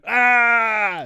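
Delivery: high far-off yell; mock kettle scream. Imagining a kettle that screams, a loud shriek. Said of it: "Just imagine".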